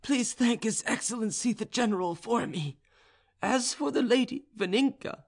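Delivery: very high character voice for the serf; high-voiced, beseeching serf; flogged serf's plea. A narrator's reading. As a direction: pitch it right up, addressing a superior. Flogged serf appeals to officer.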